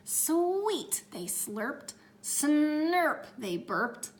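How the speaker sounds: slow, stretched and high; slow drawn-out slurping. A narrator's reading. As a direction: draw out each slurpy word. Piggy eating sounds, slow.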